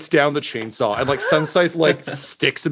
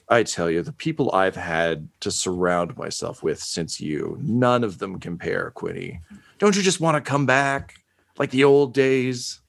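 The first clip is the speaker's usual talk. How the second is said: villain monologue. Acted. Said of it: "Villain monologue".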